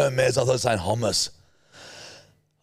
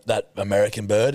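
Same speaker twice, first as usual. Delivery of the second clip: ordinary delivery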